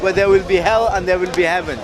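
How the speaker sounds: ordinary delivery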